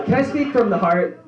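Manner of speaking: an overeager manic ask; earnest plea. Retold or imitated. Manic friend addresses the judge.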